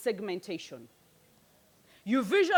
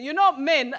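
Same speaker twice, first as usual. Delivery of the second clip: ordinary delivery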